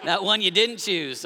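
ordinary talk, nothing marked

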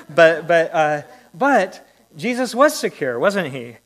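suddenly loud, rising preacher's emphasis; emphatic insistence. Real, not acted. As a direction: hammer home that he was secure. Preacher insists Jesus was secure.

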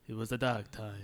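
put-on low, soft old Jedi voice; Alec Guinness. Host imitating the older Jedi actor, low soft voice. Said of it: "lower it down. Sir Alec McGuinness".

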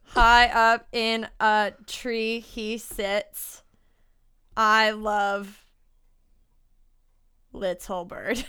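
slow, measured verse reading with long pauses; slow improvised poem; haiku recital. Pretend. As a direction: recite it word by word, making it up. Composing a haiku aloud, reciting it.

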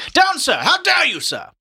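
high, loud theatrical rebuke; scolding shout. A narrator's reading. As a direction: cry out as if scolding a dog. Character scolds while clubbing grasses.

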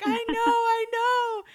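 high, slow mock whimper; a kid whimpering to her dad; whiny crying quote. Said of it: "I was like".

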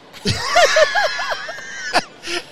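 high loud burst of laughter; helpless howling laugh; real roaring laugh. Real, not acted. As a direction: laugh out loud, nearly shouting. Big laugh at own story.

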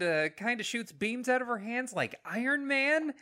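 lightly hammed-up hype for Captain Marvel; mock movie pitch. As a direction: sell her like an eager trailer voice. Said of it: "this movie tried to be".

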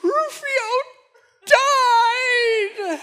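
put-on high, tearful little-girl wail, half laughing; imitating crying daughter. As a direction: mimic a heartbroken child through sobs. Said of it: "she is devastated".

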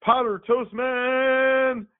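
high, drawn-out superhero announcement; slow, high heroic battle cry; hero catchphrase. Retold or imitated. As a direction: belt the name out slow like a cartoon hero. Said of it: "and he's like".

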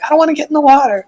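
high, fast, whiny mock wife; whiny wife impression. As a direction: whine it like his wife. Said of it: "And she's like".

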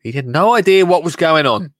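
normal talking voice

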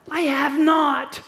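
loud, slow, firm refusal; firm denial. Acted. Martha denying the charge to Jesus, loud and slow. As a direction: dig in and deny it.

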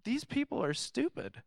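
mock disbelief; exasperated scoff. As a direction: roll your eyes at the foolish crowd. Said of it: "you're just like".

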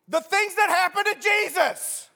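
fed-up, spitting it back at him; exasperated disciples. Acted. Disciples snap at the stranger in mock outrage. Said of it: "These guys are so mad".

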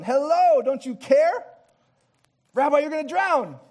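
mock-frantic, trying to wake him; panicked disciples. Disciples yelling at sleeping Jesus, mock panicked shout.

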